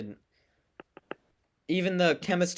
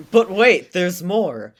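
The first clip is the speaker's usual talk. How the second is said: infomercial pitch; 5 semitones higher, 10 dB louder, slower than usual. Pretend. Infomercial catchphrase.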